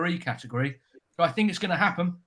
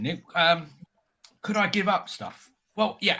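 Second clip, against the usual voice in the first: usual speaking voice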